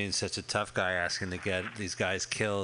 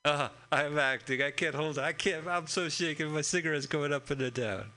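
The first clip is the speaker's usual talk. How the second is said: a light, hammed-up Italian accent, raised pitch, slowed; 5 semitones higher than usual. For fun, a guy mocks a shaking gangster in Italian accent. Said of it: "That's my Italian accent".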